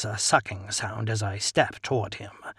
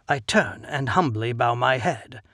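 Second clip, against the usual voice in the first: plain reading voice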